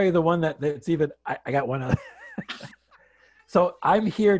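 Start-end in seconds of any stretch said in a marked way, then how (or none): 2.0-2.7 high, soft burst of laughter; real laugh. Real, not acted. Speaker eager to tell one more story, laughing. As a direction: let a laugh slip out.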